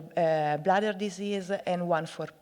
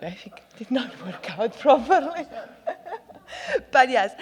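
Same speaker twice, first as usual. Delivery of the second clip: sheepish laugh through the words, then pressing on; laughing at herself; 6 semitones higher, 5 dB louder than usual. Real, not acted. Speaker laughing at her own flopped joke to the audience, amused. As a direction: laugh it off and keep going.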